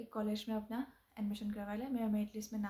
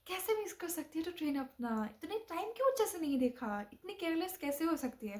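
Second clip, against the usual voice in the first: scolding tutor; 7 semitones higher than usual. Retold or imitated. Tutor scolding her on phone.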